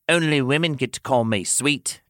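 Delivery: usual speaking voice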